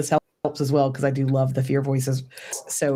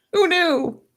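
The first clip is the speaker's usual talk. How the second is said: mock surprise. Joking after finishing a thing.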